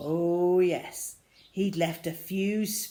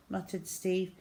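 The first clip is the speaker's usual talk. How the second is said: ordinary delivery, nothing marked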